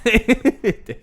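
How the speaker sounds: real loud laugh, high and breathless; loud laughter. Real, not acted. Bursts out laughing.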